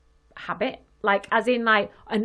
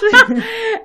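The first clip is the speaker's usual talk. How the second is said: high laugh; 11 semitones higher, 7 dB louder than usual. Real, not acted. Bursts out laughing.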